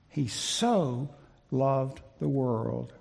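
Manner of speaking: slow, with weight on the word "so"; emphatic so. Shown as a demonstration. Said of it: "It's emphatic".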